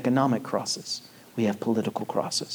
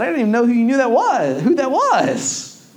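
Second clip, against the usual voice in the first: high, louder mock-surprised voice; teasing dad; 11 semitones higher, 6 dB louder than usual. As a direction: play it up as a joking father. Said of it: "I said".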